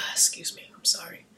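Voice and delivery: soft, breathless apology after a sigh; whispered apology. Real, not acted. Excusing himself to viewers while fighting a cold, whispered. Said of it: "Still battling this cold".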